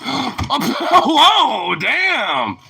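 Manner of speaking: high startled whoa with a laugh; surprised laughing yelp. Real, not acted. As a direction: yelp as the dog jumps. Dog leaps, he exclaims.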